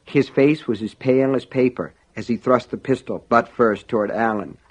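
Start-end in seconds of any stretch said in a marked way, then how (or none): none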